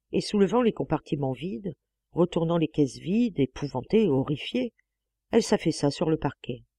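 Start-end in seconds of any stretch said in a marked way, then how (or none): none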